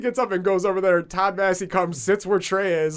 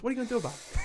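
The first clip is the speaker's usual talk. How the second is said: softer, higher mock gangster; Todd Massey; 7 semitones higher, 6 dB quieter, much faster than usual. Smoker blowing out smoke at a complainer, mock-gangster defiance. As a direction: toss off the dare after a puff of smoke. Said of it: "acts like he's a fucking mob gangster".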